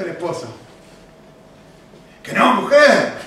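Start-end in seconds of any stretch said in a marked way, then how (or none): 2.2-3.1 high, louder snapping denial; husband's shout. Retold or imitated. Husband shouts back at wife in denial. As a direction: yell the denial back at her. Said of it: "Y le grita".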